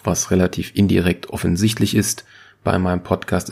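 normal talking voice

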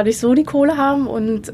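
ordinary delivery

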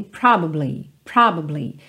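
"probably" in three syllables, PROB-uh-blee, pitched low; dictionary pronunciation. Shown as a demonstration. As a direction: keep the middle "ba" of "probably", lightly and quickly. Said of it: "a pronúncia dessa palavra no dicionário".

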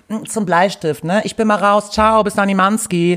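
hammed-up imitation of someone who says zum Bleistift; silly acquaintance impression. Mocking a person who talks like that, high and loud. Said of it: "Das ist auch eine, die sagt".

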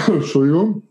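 strained apology after choking; choking on a drink. Real, not acted. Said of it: "ich habe mich verschluckt".

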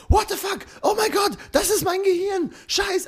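very high squeaky panicked voice; panicked vegetable voice. Retold or imitated. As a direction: squeal it like a terrified cartoon vegetable. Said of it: "der Salatkopf ist halt so".

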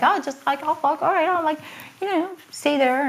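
high, breezy mock-casual voice; carefree shrug. Retelling own clueless thoughts.

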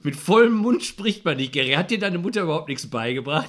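mock scolding mother. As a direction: scold him like a fussy mother. (gespielt tadelnde Mutter)

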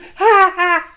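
squeaky high burst of laughter; high giggle. Acted. Rat laughs at his Katastrophentorte, high giggle. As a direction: giggle at your own joke.

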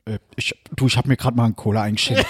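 low, sheepish dad excuse; imitated Hessian father. Father caught still consuming on the call, low imitated Hessian. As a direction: make Dad's excuse in a low voice. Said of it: "andere Personen auf Hessisch nachmachst".

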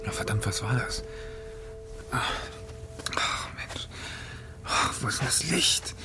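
hushed, irritated grumbling to yourself; startled muttering. Acted. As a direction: mutter softly while groping for the light. Woken by scream, fumbling in dark.

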